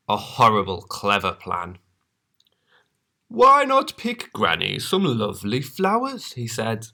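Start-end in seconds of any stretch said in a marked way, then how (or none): 3.3-6.3 high, sugary wolf voice; fake-sweet wolf. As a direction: coax her sweetly.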